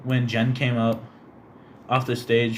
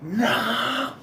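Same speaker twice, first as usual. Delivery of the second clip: high yelp; 12 semitones higher than usual. Retold or imitated. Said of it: "I was like".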